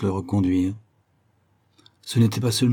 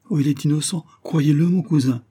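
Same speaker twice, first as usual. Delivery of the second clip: higher-pitched, earnest plea; pleading; 6 semitones higher, 3 dB louder than usual. A narrator's reading. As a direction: plead a brother's innocence to a cousin. Sister pleads her brother's innocence. (suppliante, d'une voix plus aiguë)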